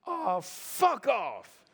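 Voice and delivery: mocking doorman slamming the door; imitating the doorman. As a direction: brush them off with contempt. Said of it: "and went".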